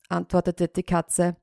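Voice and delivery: ordinary delivery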